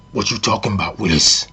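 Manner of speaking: low put-on man's voice, like a catchphrase; mock deep sitcom impression; Arnie from Different Strokes. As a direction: drop suddenly into a deep character voice. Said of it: "all of a sudden [name]'s voice changes".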